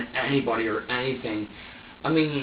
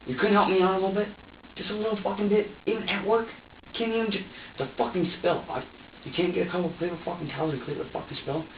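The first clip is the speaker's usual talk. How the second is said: exasperated reproach; 6 semitones higher than usual. Retold or imitated. Acted quarrel, reproachful questions.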